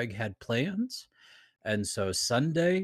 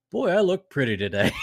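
vain boast; 5 semitones higher, 5 dB louder than usual. Retold or imitated. Quoting own vain thought.

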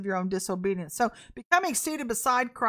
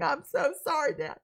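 laughing apology, pitched up; bursting out laughing; 6 semitones higher than usual. Retold or imitated. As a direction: imitate a kid apologizing through giggles. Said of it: "you bust out laughing".

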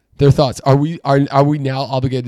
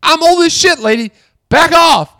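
mock furious outburst, much higher and louder; inner rant; 6 dB louder than usual. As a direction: let the imagined rant at the bartender explode. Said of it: "in my head, I'm like".

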